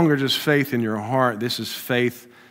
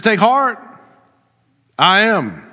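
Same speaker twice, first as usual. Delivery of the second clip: louder and higher, ringing out; proclaiming; 9 semitones higher, 8 dB louder than usual. Shown as a demonstration. Preacher giving Jesus's words to the congregation, loud proclamation. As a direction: proclaim it like the voice of God. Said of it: "It says, "I am".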